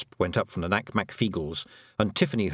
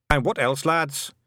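raised-pitch prompt to a crowd; lightly voiced rallying leader; 6 semitones higher, slower than usual. A narrator's reading. Tiny leader prompting his lads, rallying. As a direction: egg the lads on.

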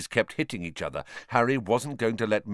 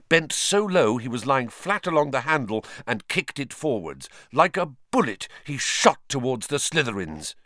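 usual speaking voice

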